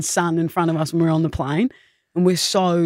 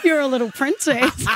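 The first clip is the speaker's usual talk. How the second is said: put-on little kid; 7 semitones higher than usual. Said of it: "And he's like".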